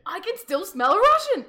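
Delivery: loud, high, hammed-up roar; suspicious witch. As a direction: holler it like an outraged fairy-tale witch. Baba Yaga returns suspicious, acted.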